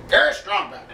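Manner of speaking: lightly voiced cartoon villain; a hint of a gruff cartoon bad guy; Strong Bad voice. Shown as a demonstration. Vlogger showing off his Strong Bad impression, lightly voiced. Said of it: "I can do s-, the strong bed in person".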